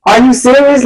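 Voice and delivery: high rising shriek of a question; retold outraged surprise; shocked disbelief. Acts out her shocked reaction.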